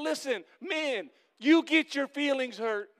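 slow down and press it on them; calling out. Real, not acted. Pastor calling out to the men in church, slow emphatic call.

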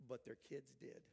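ordinary delivery

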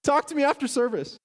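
high, loud, overjoyed yes please; a worn-out parent leaping at free babysitting, high and loud; eager parent shout. Acted. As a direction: jump at the offer like a worn-out parent. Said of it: "I need some parent to say".

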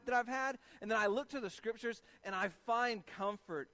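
high, loud, fervent preaching; preaching at full force. Real, not acted. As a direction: build the sermon to a passionate peak. Preaching builds to climax.

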